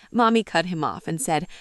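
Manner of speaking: normal talking voice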